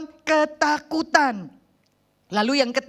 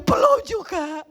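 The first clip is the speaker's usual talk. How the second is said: scared mother; 6 semitones higher, slower than usual. Retold or imitated. Frightened mother answers again.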